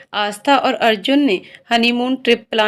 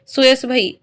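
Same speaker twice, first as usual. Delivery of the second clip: ordinary delivery, nothing marked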